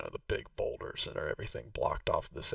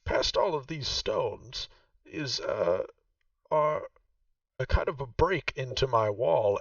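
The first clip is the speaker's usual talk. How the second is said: game master voicing Vaku, noticeably higher; Vaku's voice; 5 semitones higher than usual. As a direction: play the proud host showing her old home. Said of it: "She says".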